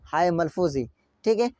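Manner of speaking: ordinary talk, nothing marked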